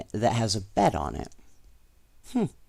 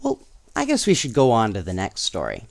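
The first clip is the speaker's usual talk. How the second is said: usual speaking voice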